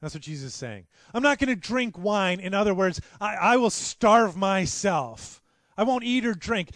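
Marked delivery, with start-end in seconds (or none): 1.1-6.7 loud, higher oath, slowing on key words; preacher voicing Jesus' oath with full force; fervent vow. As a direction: swear it to the end.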